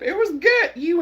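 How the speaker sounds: high, loud "It was good." with "good" rising; excited insistence. Real, not acted. Viewer insists to Chosen that the joke was good. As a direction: push it out gleefully.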